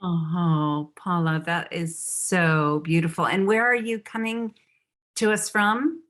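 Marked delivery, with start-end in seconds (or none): none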